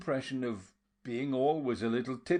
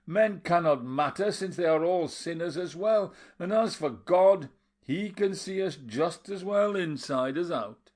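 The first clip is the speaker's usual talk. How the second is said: louder, higher, insistent; raised voice, arguing back; 6 semitones higher, 5 dB louder than usual. A narrator's reading. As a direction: keep the voice raised to argue. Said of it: "raising his voice".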